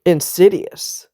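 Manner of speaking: normal talking voice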